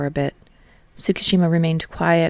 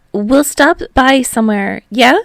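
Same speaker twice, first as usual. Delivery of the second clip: higher, louder voice for Hinata; Hinata, bright young voice; 7 semitones higher, 8 dB louder than usual. A narrator's reading. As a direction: voice the eager driver, up high.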